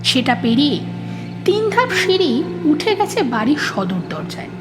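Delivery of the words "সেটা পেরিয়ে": ordinary delivery, nothing marked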